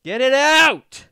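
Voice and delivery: high, slow, loud yelled quote; imitating a viral yell. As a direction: belt it out like the clip. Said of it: "just like that clip of [name]".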